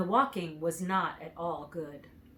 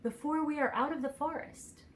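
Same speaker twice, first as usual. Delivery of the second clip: put-on child asking; 7 semitones higher, 4 dB quieter than usual. A narrator's reading. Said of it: "the child asked of the woodsman".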